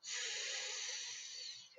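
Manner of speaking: S as a long whispered sss; drawn-out hiss on S; hissed s sound. Shown as a demonstration. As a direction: hiss the S like a snake. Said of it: "Say it with me".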